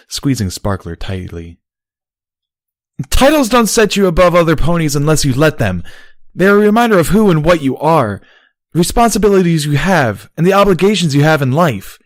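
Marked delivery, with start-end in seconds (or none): 3.1-12.0 passionate outburst. Acted. Barry's passionate lecture continues.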